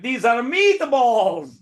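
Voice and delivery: high, very drawn-out Italian flourish; mock Italian accent. Player insisting on his nickname to opponent, mock Italian accent. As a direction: stretch it out in a hammed-up Italian accent. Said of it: "I'm like".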